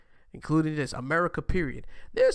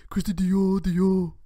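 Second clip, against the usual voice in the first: louder, higher retry of [name]; [name] impression; 4 semitones higher, 8 dB louder, slower than usual. Second try at the [name] line, loud [name] imitation. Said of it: "I try to do it".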